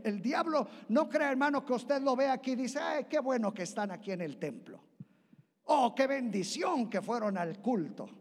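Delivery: rising, loud sermon voice; preaching at full force. Acted. Preacher warning the congregation, loud and rising. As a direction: preach it out, building.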